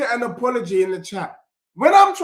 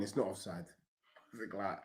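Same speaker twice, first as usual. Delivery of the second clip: ordinary talk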